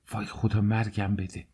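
softly voiced shock, as a narrator; dismayed exclamation. A narrator's reading. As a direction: let a hushed cry of dismay slip out. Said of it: "بی اراده چنگ زد به صورتش و گفت".

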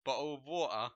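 loud, exaggerated British accent; big mock-British delivery. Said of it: "you like my British accent".

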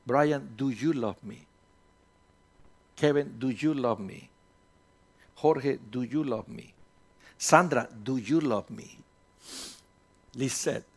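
soft, intimate asking of each name; hushed, searching plea to each listener; gentle Jesus question. Acted. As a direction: ask it tenderly, as Jesus would.

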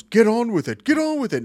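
high, loud mock heckling; imitated crowd heckle. Quoting the Monty Python crowd, high loud mock heckle. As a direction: chant it impatiently like a film crowd. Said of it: "everybody just goes".

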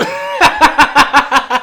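high, very loud burst of laughter; big real belly laugh, pitched high. Real, not acted. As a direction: cackle loudly at your own joke. Laughing at own movie pun.

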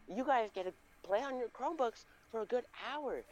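high, lilting mock-teacher cheer; delighted teacher. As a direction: hand out treats to the class gleefully. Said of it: "she would just be like".

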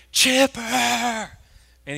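high, loud holler; mimicking a fan's sudden yell; imitated fan yell. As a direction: call out like a devoted fan. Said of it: "whenever he ye".

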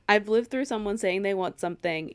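ordinary delivery

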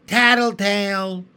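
loud sneering jeer; scornful. Acted. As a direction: spit it out with scorn. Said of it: "she said in a voice of scorn".

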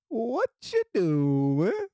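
slow, drawn-out sly "what you doing"; imitating his daughter. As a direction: stretch it out like a daughter fishing for money. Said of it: "this is how she show her love".

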